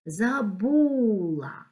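"Забула" slow, with a long stressed бу; demonstrating the stress. Shown as a demonstration. Teacher marking stresses for pupils, "Забула" with drawn-out stress on бу. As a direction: stretch the middle syllable of "Забула". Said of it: "Треба розставити наголоси".

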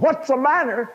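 high, loud anguished screaming; a mother screaming through tears at her teenage boy. Retold or imitated. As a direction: shriek it at your son like a heartbroken mother. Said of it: "And she screamed".

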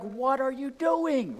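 mock road-rage complaint; exasperated outburst. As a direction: let the frustration burst out at the slow car. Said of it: "And you're like".

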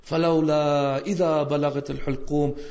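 fast, flowing recitation of a verse; Quran recitation. Acted. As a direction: recite it smoothly, devoutly.

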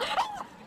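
very high startled gasp; sudden high gasp of alarm; sharp gasp. Real, not acted. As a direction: gasp in shock. Creature attacks.

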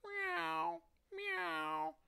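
very high imitated meowing; cat meowing thanks. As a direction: meow like a little cat. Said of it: "meowed the cat".

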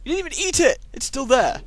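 high incredulous complaint; outraged surprise. Real, not acted. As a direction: shriek at the uneaten head.

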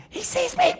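high, frightened squeak; panicked squeak. Acted. Character panics.

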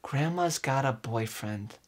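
normal talking voice

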